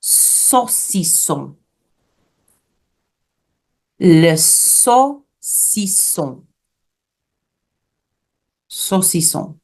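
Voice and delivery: slow "saucisson", so-see-SON; French pronunciation. Shown as a demonstration. Teacher models the word for sausage, French pronunciation. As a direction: spell out "saucisson" syllable by syllable in French. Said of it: "Sausage is pronounced saucisson".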